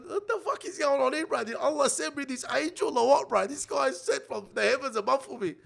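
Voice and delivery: high, amazed disbelief in his head; thinking aloud in high, half-laughing wonder; stunned inner thoughts. Retold or imitated. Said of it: "I'm thinking".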